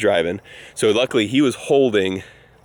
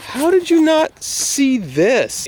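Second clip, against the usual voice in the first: much higher, slow, drawn-out disbelief; incredulous dad; 13 semitones higher, much slower than usual. Retold or imitated. Dad asks son how he missed the pole, slow stunned disbelief. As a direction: stretch each word in amazed disbelief.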